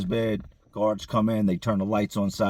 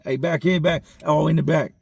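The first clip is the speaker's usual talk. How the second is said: loud higher call from the back; 5 semitones higher, 5 dB louder than usual. Retold or imitated. Inmate calling the workers to the back, loud call. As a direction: holler to get their attention.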